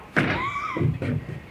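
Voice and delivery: a high, shrill, sudden gasp of shock; sharp gasp. Acted. Wonka reacts as the TV flickers, with a high gasp. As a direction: gasp as if something snapped. Mike vanishes into the TV.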